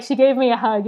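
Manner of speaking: ordinary talk, nothing marked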